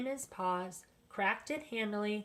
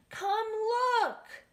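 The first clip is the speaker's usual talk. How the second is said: high excited call; children crying out; 13 semitones higher, 5 dB louder than usual. A narrator's reading. The grandchildren call everyone to the kitchen, high excited shout.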